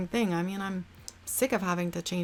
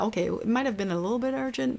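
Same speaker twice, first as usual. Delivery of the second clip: usual speaking voice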